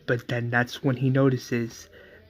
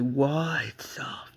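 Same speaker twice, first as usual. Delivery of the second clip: stunned whisper; 5 dB quieter, much slower than usual. Retold or imitated. Joe stunned watching Barry.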